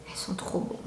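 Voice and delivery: soft whispered delight. Real, not acted. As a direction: whisper it as if sharing a secret.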